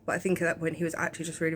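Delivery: ordinary delivery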